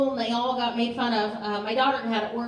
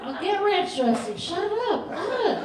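put-on squeaky kid voice; 7 semitones higher, slower than usual. Mock kid voice, high.

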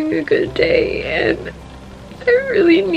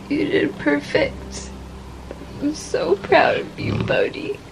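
tender praise; 8 dB quieter than usual. Real, not acted. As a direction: gently reassure the dog she loves. Praising the dog after crying.

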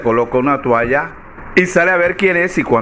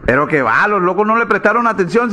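ordinary delivery

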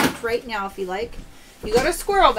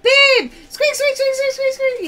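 high, loud squealing squeaks; calling husband over to squeaky dog toys; imitating excited self; 12 semitones higher, 9 dB louder than usual. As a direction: squeak like an overjoyed kid at the pet store. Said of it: "I'm always like".

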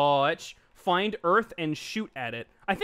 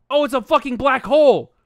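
excited shout; 6 semitones higher, 10 dB louder than usual. Real, not acted. Excited discovery.

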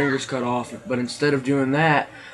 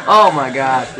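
laughing; 3 semitones higher, 4 dB louder, slower than usual. Real, not acted. Still laughing.

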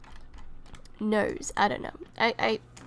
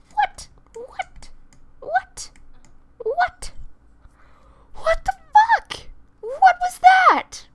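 much higher, loud shrieks of shock; shrieking disbelief; 11 dB louder, slower than usual. Real, not acted. Shrieking at the sims, much higher, loud and shocked. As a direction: screech it in total disbelief.

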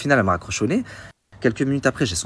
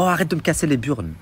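snapped, pitched up, mock rude; rude brush-off; 7 semitones higher than usual. The agent rudely cutting off her tenant, mock annoyed. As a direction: imitate her telling him off, a bit higher. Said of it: "Elle me dit, oh".

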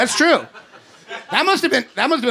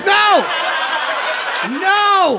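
shouted no; 5 semitones higher than usual. Acted. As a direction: shout them down in mock outrage. Shouting down imagined audience.

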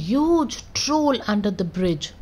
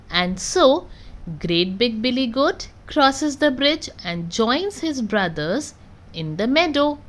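usual speaking voice

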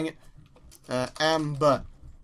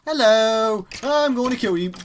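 loud, raised cry at a sudden threat; startled shout; 3 semitones higher, 6 dB louder, slower than usual. Real, not acted. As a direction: yell out in surprise, then threaten the attacker. Startled by an attacker in game.

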